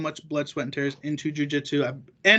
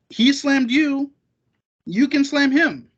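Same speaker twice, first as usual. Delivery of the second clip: higher, louder and slow, every word punched; slow emphatic scolding; 7 semitones higher, 4 dB louder, slower than usual. Real, not acted. Telling complaining grapplers off, slow, loud and emphatic. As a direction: lay it out word by word, exasperated.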